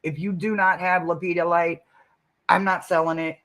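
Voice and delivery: ordinary talk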